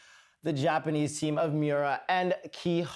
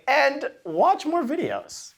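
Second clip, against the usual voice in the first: playful call; 10 semitones higher, 5 dB louder than usual. Pretend.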